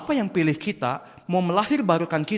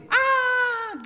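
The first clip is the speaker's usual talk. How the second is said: high-pitched mock scream; falling child's scream. Shown as a demonstration. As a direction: yelp like a kid dropping from a height. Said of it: "anak kan kalau jatuh gini".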